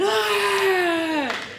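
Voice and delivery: high, loud hammed-up yelp of fright; mock panic yell. Instructor acting out a paranoid reaction to a tire bang, mock panic yelp. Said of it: "and then you get down, and".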